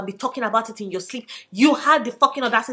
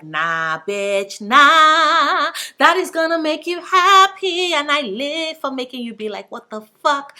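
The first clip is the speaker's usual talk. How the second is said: loud, high smug cat voice; voicing the cat; 7 semitones higher, 7 dB louder, slower than usual. Cat taunting its owner, loud and high. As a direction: gloat like a cat who loves to troll. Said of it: "my cat is like".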